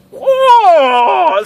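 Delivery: high, loud startled "Whoa!"; alarmed whoa. Retold or imitated. Reacting to her admitting she would keep stalking, startled and loud. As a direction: recoil in mock alarm. Hearing she'd stalk him longer.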